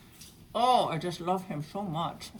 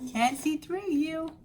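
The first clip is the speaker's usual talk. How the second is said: playful teasing; 10 semitones higher, 3 dB louder than usual. Real, not acted. Teasing cat blocking camera.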